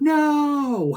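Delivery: high, loud groaning shout at the pitch; fan yell. Retold or imitated. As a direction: yell in protest from the stands. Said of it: "he'd be like".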